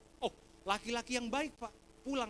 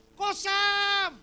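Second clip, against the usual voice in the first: high, full-volume call of recognition; loud reenacted shout to a friend; excited shout; 11 semitones higher, 12 dB louder than usual. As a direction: holler the name, stretching it out. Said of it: "langsung saya teriak".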